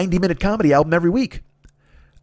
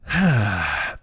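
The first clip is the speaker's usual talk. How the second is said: weary sigh; 7 semitones lower, 3 dB quieter than usual. Retold or imitated. Dread of next week's show.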